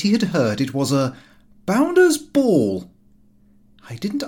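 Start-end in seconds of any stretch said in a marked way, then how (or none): 1.6-2.9 high, slow, loud put-on slang; Lupin's slang. A narrator's reading. Lupin using his odd slang to his father, high and slow. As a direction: drawl out the slang like a cocky youth. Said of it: "in his usual incomprehensible language".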